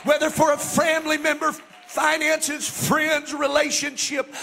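loud, high preacher's cry; full-force preaching. Acted. Pastor urging the church to believe, full-force preaching. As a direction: preach it, building to a shout.

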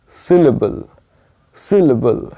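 Syllable with weight on SIL, lingering there; stressed syllable demo. Shown as a demonstration. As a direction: lean on the first syllable of syllable, falling away after. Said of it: "loud बोलना है, यानी थोड़ा सा रुकना है". (Syllable, पहले अक्षर पर ज़ोर देकर)

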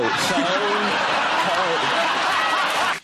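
mock alien voice. E.T. line with higher home.

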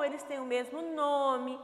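usual speaking voice

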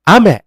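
อาเมะ with high อา, falling to เมะ; rain pitch accent. Shown as a demonstration. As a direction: hit อา high, drop on เมะ. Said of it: "ส่วนฝนน่ะ ฝนตกคือ". (อาเมะ เสียงสูงที่อา แล้วลงที่เมะ)

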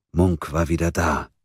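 usual speaking voice

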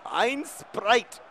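high, loud mock German; mock German word; German accent. Shown as a demonstration. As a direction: bark it out German style. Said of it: "practice my German".

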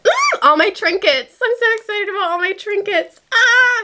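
high gleeful squeal; real excitement. Real, not acted. Delighted by all the new trinkets, excited squeal. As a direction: squeal with glee, ending in a cry.

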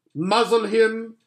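high, loud witch order; witch command. Acted. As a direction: snap it out as the cruel witch. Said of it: "said the witch".

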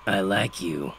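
father's rough voice, mellow and matter-of-fact; understated approval from a gruff dad; gravelly father. A narrator's reading. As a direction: say it simply in a worn gravelly voice.

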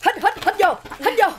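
cao và to, hô hào hít vào; giục hít mạnh vào. Pretend. Hít mùi hộp kẹo. (hô to hít vô)

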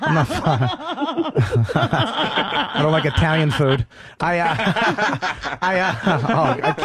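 words broken up by real laughs; laughing while talking. Real, not acted. Host cracking up at co-host's interruption, laughing helplessly. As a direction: struggle to speak through laughter.

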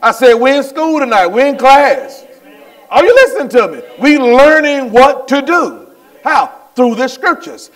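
loud, high-pitched insistence; emphatic preacher repeating the point; fervent preaching. Real, not acted. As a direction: hammer it home to the congregation. Preaches at full force.

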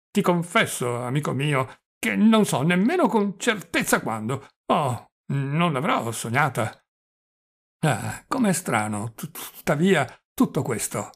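stammering, doddering confession; flustered old prince. Acted. Old prince confessing a proposal to his nephew, flustered and stammering. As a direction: draw words out, stumble on consonants.

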